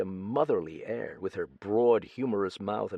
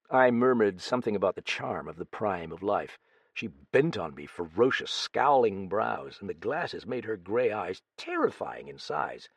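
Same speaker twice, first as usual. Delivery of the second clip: ordinary delivery, nothing marked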